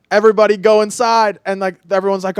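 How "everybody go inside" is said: loud, raised call to the group; retold coach's order. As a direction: imitate a coach ordering everyone in, loudly. Coach ordering class indoors.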